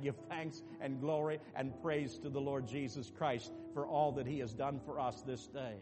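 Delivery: usual speaking voice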